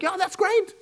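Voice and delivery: very high, mock-delighted then baffled; hammed-up fake cheer turning to confusion; high mock enthusiasm; sarcastic crowd. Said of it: "anybody in the crowd would be like".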